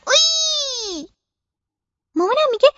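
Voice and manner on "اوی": much higher, very slow drawn ow; a child faking a hurt tummy; dragged-out whimper of pain.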